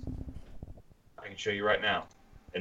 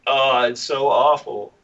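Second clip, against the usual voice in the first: fake crying; 5 semitones higher, 4 dB louder, slower than usual. Mocking married life.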